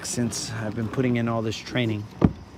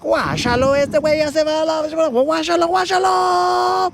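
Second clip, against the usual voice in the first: much higher, building louder Mexican sports announcer; 5 dB louder than usual. Pretend. Mexican race announcers calling out the skier, high and building. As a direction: hype it up like a Spanish-language commentator calling a race. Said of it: "That's how the Mexican announcers would be".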